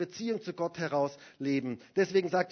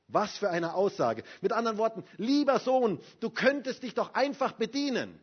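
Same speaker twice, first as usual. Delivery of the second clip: louder, higher pleading sermon; impassioned pastor, louder and higher; fervent preaching; 5 semitones higher, 6 dB louder than usual. Acted. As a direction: preach it out to the congregation. Preaching at full force.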